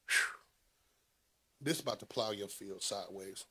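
ordinary delivery

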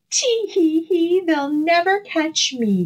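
high giggling boast; gleeful cry. A narrator's reading. Gingerbread man on the fox's nose, high gleeful giggle. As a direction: crow it out, thinking he's safe. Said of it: "he cried".

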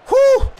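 sharp high whew of relief; high relieved whew. Real, not acted. Relief after defending.

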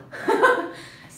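high, loud burst of laughter; laughing. Real, not acted. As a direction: laugh out loud at your own remark. Laughs at own Turkish remark.

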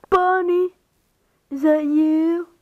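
high, loud puppet voice; excited marionette character. Acted. Marionette recognising Bonnie, high excited voice.

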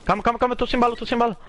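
high, urgent pleading; frantic out-of-bullets panic; panicked. Real, not acted. Out of ammo mid-fight.

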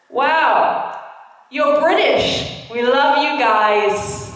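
bright American gush from an Israeli soldier; American accent. Retold or imitated. Soldier at checkpoint greeting the British passport, American accent. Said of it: "in an American accent".

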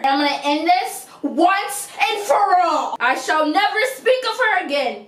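mock-dramatic ultimatum; melodramatic vow. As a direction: swear it grandly, pitch climbing. Acted dramatic vow about rival.

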